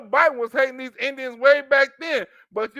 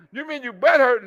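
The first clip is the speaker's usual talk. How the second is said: ordinary talk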